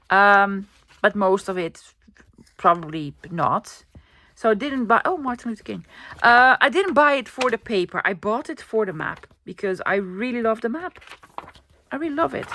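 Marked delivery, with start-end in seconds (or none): none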